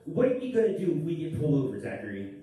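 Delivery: a hint of a fretting mother; imitating his mom. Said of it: "That's how she talks".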